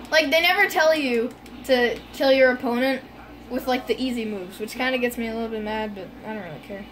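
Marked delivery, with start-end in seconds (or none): none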